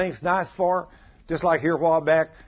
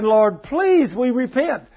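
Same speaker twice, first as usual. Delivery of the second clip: raised-pitch repentant plea; acted pleading; 7 semitones higher, 4 dB louder, much slower than usual. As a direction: plead with God, pitch climbing. Couple's pleading prayer acted out.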